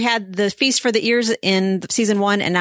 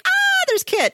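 much higher, gushing squeal of delight; gushing fan. Retold or imitated. Fan seeing Kit revealed, gushing high squeal.

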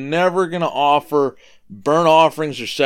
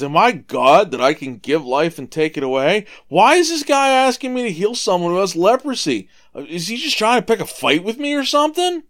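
rising, higher and louder exasperated outburst; upset king; 7 semitones higher, 4 dB louder than usual. Retold or imitated. King of Israel reading the letter, exasperated and upset. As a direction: climb into a panicked, offended rant. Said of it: "he tears his clothes 'cause he's so upset".

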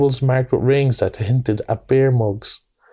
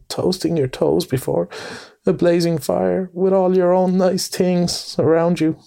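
higher, pleading lament; a mole lamenting his poor home to his friend; dismal self-reproach; 6 semitones higher than usual. A narrator's reading. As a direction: bemoan it all miserably. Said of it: "he cried dismally".

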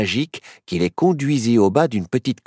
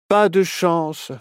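put-on slow, let-down sigh of a boy; disappointed; 6 semitones higher, slower than usual. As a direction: drag it out, disappointed. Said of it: "dit Nao déçu". (Nao déçu, voix lente)

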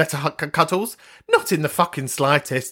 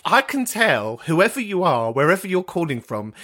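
ordinary talk, nothing marked

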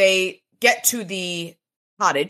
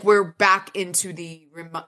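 plain reading voice

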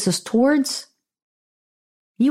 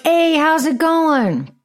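slow, friendly and a bit higher; friendly greeting; 5 semitones higher, slower than usual. Retold or imitated. Bartender greeting a sulky customer, slow and friendly. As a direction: greet him sweetly, drawing it out. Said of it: "I make a comment like".

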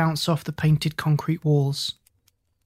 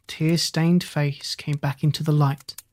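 usual speaking voice

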